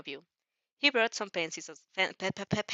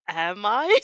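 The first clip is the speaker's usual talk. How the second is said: loud retort; 10 dB louder than usual. Acted. Reader's character retorts, louder.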